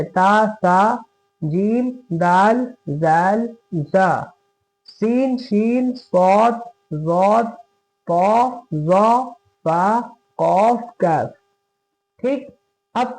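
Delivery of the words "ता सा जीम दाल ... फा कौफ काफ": slow recital of Arabic letter names; reciting letter names. Shown as a demonstration. Teacher recites ikhfa letters to students, slowly. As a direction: list the fifteen letters slowly, one by one. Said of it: "हुरूफ इख्फा पन्द्रह है".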